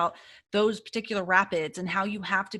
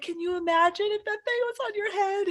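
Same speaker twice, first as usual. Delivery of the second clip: incredulous; 12 semitones higher than usual. Retold or imitated. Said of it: "We were like".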